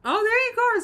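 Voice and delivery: loud, high-pitched cheer; excited, ringing praise; delighted cheer. Real, not acted. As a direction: cheer them on at full voice. Cheers a chatter who is learning.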